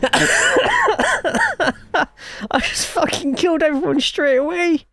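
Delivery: squealing, horrified confession; high-pitched disbelief. Real, not acted. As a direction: shriek it in disbelief. Just wrecked the whole field.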